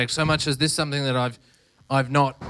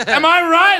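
high, loud plea for backup; mock indignant appeal; 14 semitones higher, 8 dB louder, slower than usual. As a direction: call out to bystanders for agreement. Acted appeal to bystanders.

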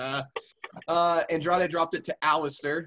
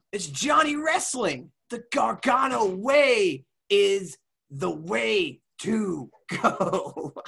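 slow, raised, drawn-out mock promo; Johnny Gargano; 4 semitones higher, slower than usual. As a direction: stretch each phrase like a ring announcement. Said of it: "You get Johnny Gargano".

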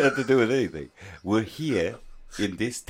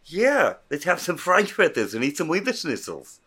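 high hammed-up Austrian, bouncy tune; mock Austrian accent; 8 semitones higher than usual. Joking about Austrian food in a mock Austrian accent.

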